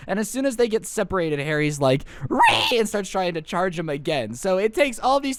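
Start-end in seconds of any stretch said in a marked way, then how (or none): 2.3-2.8 pig squeal. Retold or imitated. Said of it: "who is just reeing the entire time".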